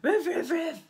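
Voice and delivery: loud high mock barks; barking. The dog answers the call, loud mock barking. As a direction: bark like a playful dog.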